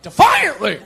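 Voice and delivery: loud, high comic bellow; defiant shout. Acted. Comic texting back a girl's offer, defiant shout. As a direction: shout it like a battle cry. Jokey misspelt eager reply.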